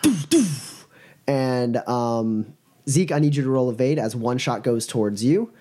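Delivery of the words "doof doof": mouth-made gunfire sounds; gunshot noises. Acted. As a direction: pop out two shots with your mouth. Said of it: "uh, guns, uh, go".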